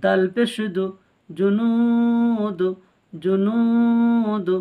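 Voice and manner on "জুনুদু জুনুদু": জুনুদু chanted very slowly, the নু held long; long madd. Shown as a demonstration. The teacher demonstrates the madd in জুনুদু to learners. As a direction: stretch the waw madd in জুনুদু. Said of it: "ওয়াও মাদ্দা এক আলিফ টান দিয়ে পড়তে হয়".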